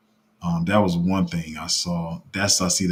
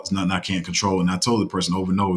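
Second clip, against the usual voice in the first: ordinary delivery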